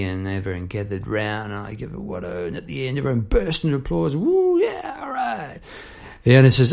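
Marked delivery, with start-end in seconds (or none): 4.2-5.6 very high whooping cheer; crowd cheering. Retold or imitated. Workmen applauding the piano man, high whooping cheer.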